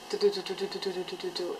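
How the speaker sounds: rapid-fire mimicked heartbeat; racing heartbeat imitation. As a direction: rattle off quick, even heart thumps. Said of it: "my heart was just like".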